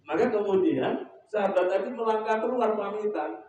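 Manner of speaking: ordinary talk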